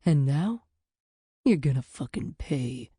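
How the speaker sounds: low, menacing dream villain's threat; angry threat. Acted. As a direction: spit it out as a threat before the dark. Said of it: "his angry face".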